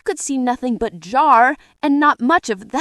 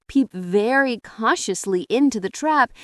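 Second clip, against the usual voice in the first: ordinary delivery, nothing marked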